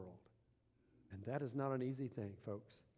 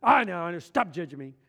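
angry coworker; 3 semitones higher, 12 dB louder than usual. Retold or imitated. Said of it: "he was just mad".

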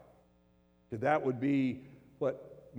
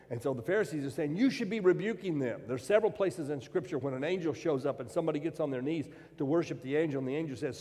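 loud, high-pitched preaching; fervent pulpit preaching; preaching at full force; 6 semitones higher, 6 dB louder than usual. Acted. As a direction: drive the point home to the congregation. Preacher rising into forceful delivery.

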